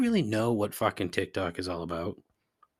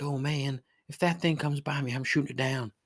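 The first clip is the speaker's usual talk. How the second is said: put-on Southern accent; 5 semitones higher than usual. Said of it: "they have a Southern accent".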